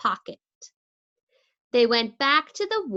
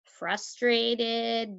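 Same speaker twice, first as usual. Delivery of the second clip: plain reading voice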